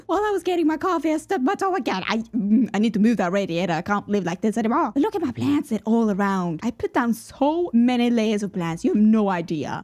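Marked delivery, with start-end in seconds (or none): none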